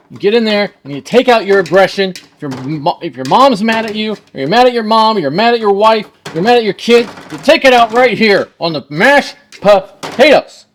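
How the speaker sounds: loud, high-pitched comic venting; mock aggression. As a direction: take out hammed-up rage on the potatoes, punching each phrase. Mock venting anger while mashing.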